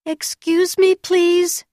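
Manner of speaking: high, loud call into the dark. A narrator's reading. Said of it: "called out".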